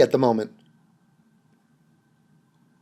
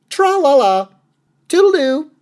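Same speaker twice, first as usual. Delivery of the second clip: high, louder singsong goodbye; singsong mockery; mock airy farewell; 7 semitones higher, 4 dB louder than usual. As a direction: trill it like a silly fop skipping off. Mocking the man's breezy exit.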